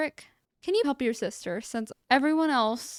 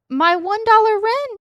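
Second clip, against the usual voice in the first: much higher and louder, incredulous; outraged disbelief; 11 semitones higher, 10 dB louder than usual. Real, not acted. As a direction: protest the absurd fine. Baffled by a tiny rent bill.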